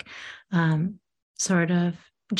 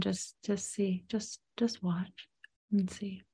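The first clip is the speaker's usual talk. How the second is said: slow and much softer, calm meditation guide; soft, slow guidance; 9 dB quieter than usual. Acted. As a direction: ease her into stillness gently. Guiding meditation softly.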